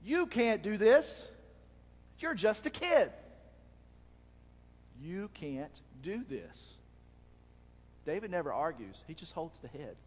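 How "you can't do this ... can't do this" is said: slow, louder insistence that fades away; a hint of doubting king. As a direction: dismiss the boy as a mere kid. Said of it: "what was it that Saul said".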